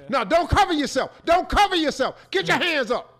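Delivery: high, forceful comic commands; acted angry orders. Homeowner holding up the naked intruder, commanding. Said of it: "Now say it if it was you".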